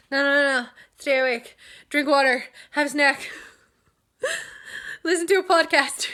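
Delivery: urging yourself to stay awake, half laughing; pep talk to self. Retold or imitated. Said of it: "you're going".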